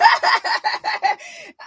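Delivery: long high-pitched cackle; real laughter. Real, not acted. Reacting to the played clip.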